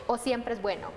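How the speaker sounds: usual speaking voice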